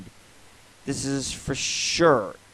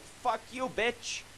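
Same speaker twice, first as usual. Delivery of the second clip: mock yell; 10 semitones higher, 6 dB quieter, slower than usual. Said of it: "you're like".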